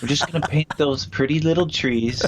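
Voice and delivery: lightly voiced gentle painter; a hint of soothing TV painter; Bob Ross voice. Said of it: "Bob Ross sort of vibe".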